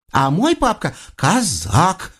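high, slow boast; little girl showing off her daddy; proud child. A narrator's reading. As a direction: declare it proudly, stretching the last word. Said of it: "с гордостью заключила Дашка".

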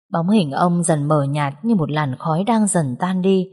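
plain reading voice

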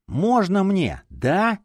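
high, insistent begging; pleading. A narrator's reading. Champion begs the chief for the detective, pleading. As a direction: beg like someone promised a treat. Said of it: "с мольбой посмотрел на главного".

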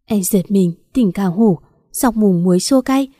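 usual speaking voice

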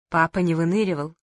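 ordinary delivery, nothing marked

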